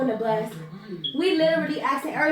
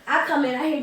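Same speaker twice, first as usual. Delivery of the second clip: plain reading voice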